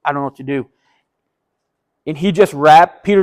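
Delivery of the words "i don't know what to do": nervous quiet. Retold or imitated. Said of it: "Matthew's standing there like".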